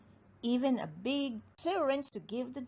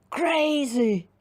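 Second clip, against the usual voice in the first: loud giant voice; 5 semitones higher, 10 dB louder than usual. Acted. Giant says crazy again.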